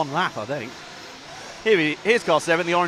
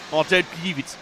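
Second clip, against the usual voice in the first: a light South African accent impression; lightly voiced South African accent, not a good one. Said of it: "mine for a South African accent".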